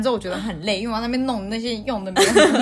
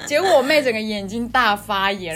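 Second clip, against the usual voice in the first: usual speaking voice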